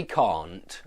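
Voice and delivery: can't with a long ah as in car; British can't. Shown as a demonstration. Teacher demonstrating British can't. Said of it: "but we say".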